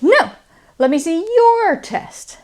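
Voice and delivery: "your" stressed high and loud, flipping it back. Shown as a demonstration. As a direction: push "your" up, loud and clear. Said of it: "I'm stressing this word".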